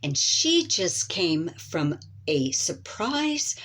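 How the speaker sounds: smooth host announcement building to a welcome; talk show host. Acted. Host welcomes Elizabeth onto the stage. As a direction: introduce the guest like a TV host.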